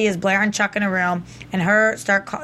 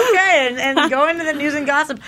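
DJ voice; 5 semitones higher, faster than usual. Pretend. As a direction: hype it up like a radio host. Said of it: "but not my D J voice".

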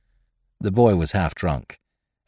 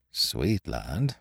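soft, lower murmur; 6 semitones lower, 9 dB quieter than usual. Acted. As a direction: mutter it under your breath. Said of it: "murmured Ned Low".

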